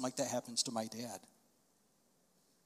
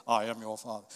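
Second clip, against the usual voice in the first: Darth Vader; 3 semitones lower than usual. Shown as a demonstration. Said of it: "I'm not saying it like the guy did".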